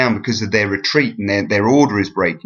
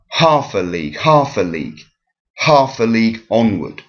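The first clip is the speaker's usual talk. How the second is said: HALF hit hard, "a league" falling away each time; falling dactylic stress. Shown as a demonstration. Teacher showing strong-weak-weak in the poem, falling dactylic stress. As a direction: chant half a league with the beat on half. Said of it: "the first syllable is stressed".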